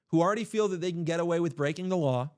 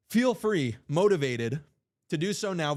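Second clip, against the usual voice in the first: ordinary delivery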